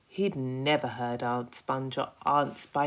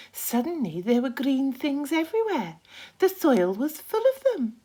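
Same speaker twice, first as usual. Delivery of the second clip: higher, louder spider voice; Miss Spider voice; 13 semitones higher, 8 dB louder than usual. Acted. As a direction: tell it with eager wonder.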